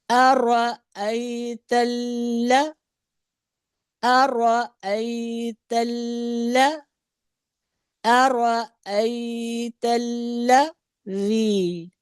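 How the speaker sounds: अरअयतल with the lam held and doubled, very slow. Shown as a demonstration. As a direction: pause and hold on the ल्ल of अरअयतल्लज़ी. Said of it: "उसको रुक के पढ़ेंगे।".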